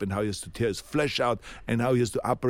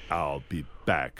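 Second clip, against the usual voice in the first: low, slow, deliberate line; movie catchphrase delivery; 8 semitones lower, 3 dB quieter, slower than usual. Shown as a demonstration. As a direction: drop low and stretch it out like the film. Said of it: "the way you say it in the movie".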